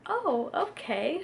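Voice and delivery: high, drawn-out, taken-aback okay; cute surprise. Retold or imitated. Insurance woman taking in the news, cute surprise. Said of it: "the woman was so cute".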